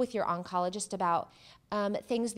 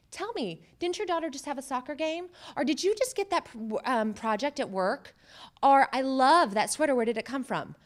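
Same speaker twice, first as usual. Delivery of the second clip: higher, louder cheerful redirect; bright topic switch; 4 semitones higher, 4 dB louder than usual. Shown as a demonstration. Speaker demonstrating shifting the talk to the other person, cheerful. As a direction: toss the spotlight back with upbeat interest. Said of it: "Boom, throw it back".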